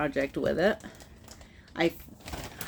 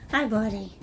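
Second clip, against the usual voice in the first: higher, sing-song hello to the dog; dog-directed voice; 6 semitones higher than usual. Real, not acted. Greeting her dog.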